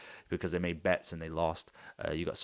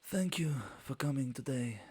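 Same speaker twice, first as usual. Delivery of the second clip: high whispery halfling announcing the winner; halfling announcer; 8 semitones higher than usual. Acted. GM voicing the halfling presenting the tournament winner, high and hushed. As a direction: hush the crowd like a sly little showman. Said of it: "comes out now and says".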